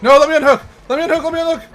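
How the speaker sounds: high, loud frantic yelling; panicked shouting. Real, not acted. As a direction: scream it in panic. Panicked in the game.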